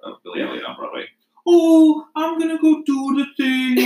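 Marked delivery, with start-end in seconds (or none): 1.5-3.8 high, loud cartoonish Geordie; exaggerated high-pitched Newcastle impression; mock Newcastle accent. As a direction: imitate a Billy Elliot cast member in Geordie. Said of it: "Amber literally talks like the entire cast of".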